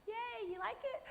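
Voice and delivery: very high eager chirp; perky eager. Retold or imitated. Showing the judges her dress, high eager chirp. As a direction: fish for praise like a kid. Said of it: "that's how I was".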